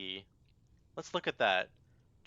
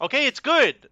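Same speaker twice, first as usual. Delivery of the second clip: exasperated shout; 11 semitones higher, 7 dB louder than usual. Real, not acted.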